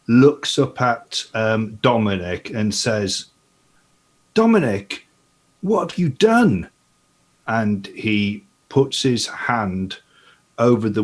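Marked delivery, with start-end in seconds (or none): 4.3-6.7 high-pitched, dismayed exclamation; alarmed character. Acted. Gulliver kneels and confronts Dominic, high and dismayed. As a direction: cry it out in shock, pitch jumping up.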